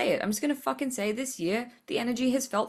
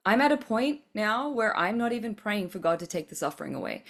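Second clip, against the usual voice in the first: ordinary delivery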